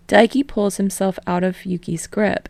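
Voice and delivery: ordinary delivery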